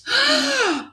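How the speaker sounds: sharp high shocked gasp. Acted. Princess spotting the king's crown, high gasp. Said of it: "and gasped".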